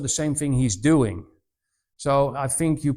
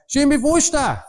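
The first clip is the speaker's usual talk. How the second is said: much higher, louder valley call; imitated shouted reply; 10 semitones higher, 5 dB louder than usual. Traveller answering across the valley, acted shout. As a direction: holler the place name across the distance. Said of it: "I was shouting".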